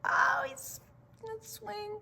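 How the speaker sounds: ordinary delivery, nothing marked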